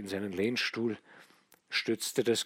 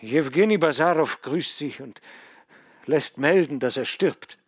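louder, higher, feverish commands; feverish insistence; 7 semitones higher, 11 dB louder than usual. A narrator's reading. Dying son ordering father to send for Odinzow, loud and feverish. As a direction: rattle off orders through the fever.

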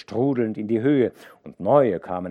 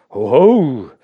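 rufend; 5 semitones higher, 16 dB louder than usual. A narrator's reading. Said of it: "rief Hauke". (lauter Zuruf)

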